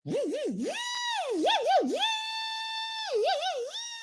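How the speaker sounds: long, high mock drill whine, held steady; drawn-out drill noise held high; dentist drill impression. Shown as a demonstration. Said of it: "I'm gonna do it again".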